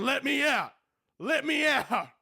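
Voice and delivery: high, whiny plea; begging caged creature. Acted. Caged kitty begging to get out, high whine. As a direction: whimper it like something gnawing at bars.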